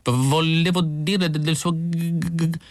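high, halting stammer that keeps breaking off. A narrator's reading. Isidor stammering to Mrs Pilsen, high and halting. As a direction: trip over every word, nervously. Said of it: "si interruppe".

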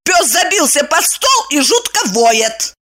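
high-pitched indignant protest. A narrator's reading. Servant scolds master for scaring household, indignant. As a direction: list your complaints in a high voice. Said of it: "возмутилась она".

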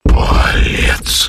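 loud breathy gurgling rasp; a voiceless monster bark; gurgling tentacle alien. Acted. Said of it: "это и голосом нельзя было назвать".

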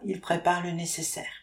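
ordinary delivery, nothing marked

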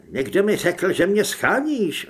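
ordinary delivery

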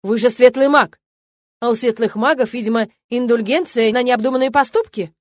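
loud sarcastic rebuke; angry outburst. A narrator's reading. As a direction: shout the sarcasm at him. Rector's outburst at student.

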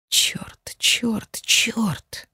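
breathless whispered curses; whispering. A narrator's reading. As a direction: hiss it under your breath. Heroine curses alone, shaken.

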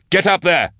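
high, urgent command; yelled order. A narrator's reading. Drusha orders Max up the chimney, urgent yell. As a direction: yell her into moving. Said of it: "he yelled at her".

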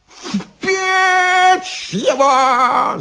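high, drawn-out witch screech; yelling. A narrator's reading. Baba Yaga orders Ivan into the oven, yelling. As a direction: screech the order, stretching the words. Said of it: "заорала Яга и затопала ногами".

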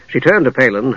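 ordinary delivery, nothing marked

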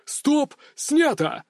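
high-pitched call, no real volume; director's cry. A narrator's reading. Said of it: "Раздаётся очередной вопль режиссёра".

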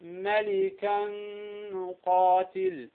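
ملكا نقاتل with the tanween merged into the nun; slow nasal merge in ملكا نقاتل; merged ghunna. Shown as a demonstration. Teacher demonstrating idgham with tanween. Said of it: "أيضًا مع التنوين".

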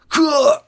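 high, sharp pained yelp; choked cry cut off. A narrator's reading. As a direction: yelp as the arrow hits. Rider struck by arrow.

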